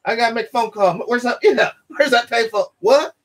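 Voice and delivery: a hint of a lost man hunting for a payphone; lightly acted searching ex-con.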